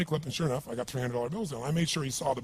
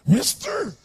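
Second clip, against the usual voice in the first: high, loud, slow begging voice; bizarre drunk voice; 11 semitones higher, 9 dB louder, slower than usual. Retold or imitated. Drunk man begging a passer-by for a quarter, bizarre voice. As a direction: beg for a coin in a strange high voice. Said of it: "He had this really bizarre looking sounding voice".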